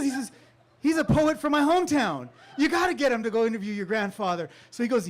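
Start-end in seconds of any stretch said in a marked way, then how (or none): 0.8-4.5 high, loud, laughing push; excited urging. Retold or imitated. Friend urging Pete's grandson, excited laughing. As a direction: insist eagerly with a laugh.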